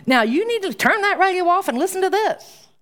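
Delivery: high, hammed-up bossy command; a teasing imitation of a nagging mother; mock bossy order. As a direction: play the pushy parent laying down the law. Mock parental order in sermon.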